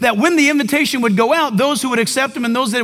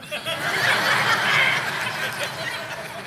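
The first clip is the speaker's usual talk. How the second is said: breathy amused laugh; 11 semitones higher, 8 dB quieter than usual. Real, not acted. Laughing at yard work joke.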